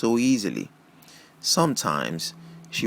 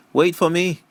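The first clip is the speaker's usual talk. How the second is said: calling after; 8 semitones higher, 4 dB louder than usual. Acted. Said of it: "I called after her".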